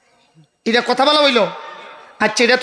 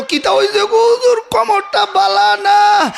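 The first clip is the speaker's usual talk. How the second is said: complaining worshipper; 7 semitones higher than usual. Retold or imitated. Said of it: "হুজুরে কো".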